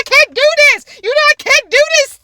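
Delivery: high, loud mock panic; freaking out. Mom desperate to wash off sanitizer, freaking out. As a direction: imitate mom's disgusted, high-pitched fluster. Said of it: "She just freaked out".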